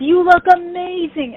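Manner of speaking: high, slow, sweet imitation of the singer; gushing singer. The singer praising her costumed fan, high and cooing. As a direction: coo it up high like an adoring star. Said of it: "And she's like".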